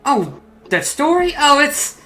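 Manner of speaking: high, airy showman's reply; puppeteer in character. Acted. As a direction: answer an admirer, pitched well up. Puppeteer answers a question in character.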